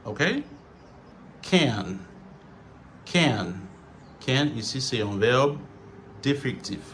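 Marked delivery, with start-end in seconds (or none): 1.4-3.5 "Can" with a full open vowel; careful, stressed "Can"; normal full pronunciation. Shown as a demonstration. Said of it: "c'est prononciation normale".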